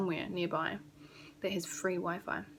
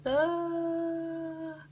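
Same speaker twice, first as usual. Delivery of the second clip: mock panic cry; 9 semitones higher, 4 dB louder than usual. Mock panic over virus contact.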